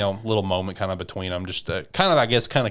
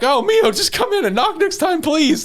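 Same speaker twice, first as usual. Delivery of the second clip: shrill panicked plea, much higher and louder; freaking out; 5 dB louder than usual. Retold or imitated. Shinpei freaking out at Mio barging in, high panicked plea. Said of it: "just freaking out".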